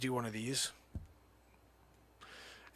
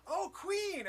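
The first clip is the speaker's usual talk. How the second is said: high mock courtly greeting; 6 dB quieter than usual. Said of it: "So I went".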